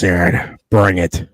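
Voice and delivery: normal talking voice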